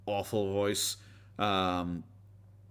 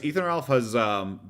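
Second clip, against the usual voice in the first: usual speaking voice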